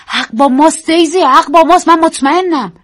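high loud insistence; insistent. Acted. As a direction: insist you are right. Insisting passionately. (پافشاری بلند و پرشور)

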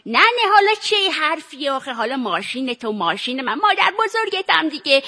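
put-on elderly Iranian grandmother voice; character voice of an old woman; old granny. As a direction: play her as an affectionate old granny. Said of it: "چرا پیر شدی؟".

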